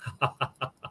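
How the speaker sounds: short soft laugh on cue; demo laugh. Shown as a demonstration. Teacher laughs to show what he notices, soft laugh. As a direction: laugh quietly to show the example. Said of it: "example, I laugh".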